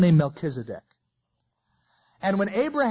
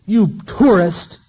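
louder, drawn-out furious yell; an angry husband on the beach yelling at his wife; enraged insult; 5 semitones higher, 10 dB louder, much slower than usual. Retold or imitated. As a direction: spit out the insult like the worst curse. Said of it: "this guy just got enraged and he said".

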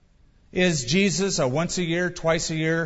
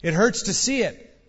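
higher-pitched mild cry of protest; pained cry; 5 semitones higher than usual. A narrator's reading. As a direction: complain as if hurt by glare. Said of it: "they cried".

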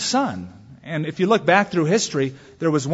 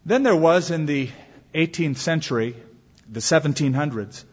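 ordinary talk

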